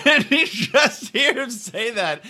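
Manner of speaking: ordinary talk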